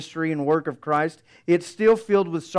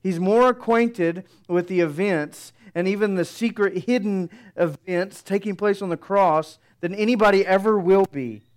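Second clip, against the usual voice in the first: normal talking voice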